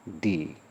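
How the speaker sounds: "दी" with a long ee, like "thee". Shown as a demonstration. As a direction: stretch the vowel in "दी". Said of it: "वह होगा". ("दी" लंबी ई के साथ)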